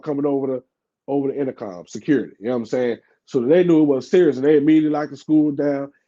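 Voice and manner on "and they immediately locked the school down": ordinary delivery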